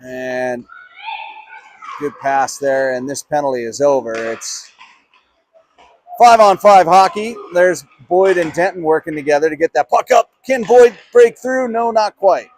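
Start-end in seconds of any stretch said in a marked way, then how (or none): 6.2-12.5 loud, high play-by-play surge; excited call. Real, not acted. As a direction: push the voice up as the chance develops. Live call of a breakaway attempt.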